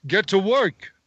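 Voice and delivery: mock a teacher telling a student to get working; Kershner impression. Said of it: "Imagine your teacher telling you that".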